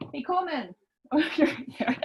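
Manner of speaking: high, bright call to her son; mum voice. Pretend. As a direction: call him like a mom. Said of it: "I'm just gonna use my mum voice here".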